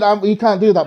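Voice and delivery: usual speaking voice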